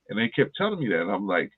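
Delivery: usual speaking voice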